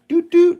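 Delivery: imitating a horn beep at the old man; mock horn toot. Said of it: "I honk my horn".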